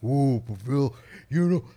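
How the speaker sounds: high raspy wrestler promo, building to a shout; Macho Man Randy Savage. Retold or imitated. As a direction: growl out a wild wrestling promo. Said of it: "Can you do the Macho Man, Randy Savage".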